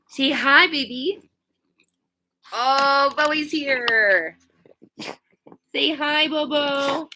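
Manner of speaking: high, sweet, slow-ish pet voice. Real, not acted. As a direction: gush over the puppy. Baby talk to her dog.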